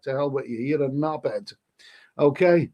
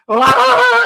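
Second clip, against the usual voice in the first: mock ecstatic cry; 13 dB louder than usual. Overblown cry after come-on.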